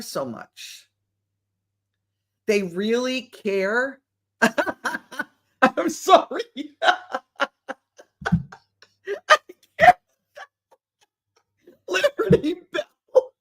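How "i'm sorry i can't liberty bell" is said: high-pitched, laughing apology; helpless laughing fit. Real, not acted. Host reacting to Liberty Bell's comment, helpless laughter. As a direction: unable to get the words out for laughing. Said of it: "it struck me so funny".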